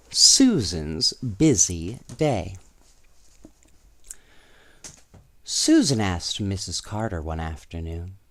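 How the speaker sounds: put-on lively read-aloud voice for children; storybook reading; story narration. A narrator's reading. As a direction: tell it like a bedtime storybook.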